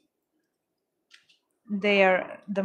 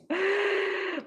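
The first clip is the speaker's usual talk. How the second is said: startled gasp; 10 semitones higher, 5 dB quieter than usual. Real, not acted. Startled by order notification.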